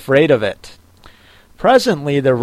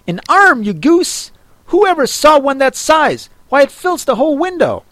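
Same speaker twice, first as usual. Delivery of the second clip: higher, fast, exasperated master; scolding Rabbit; 9 semitones higher, faster than usual. A narrator's reading. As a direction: scold the dim servant.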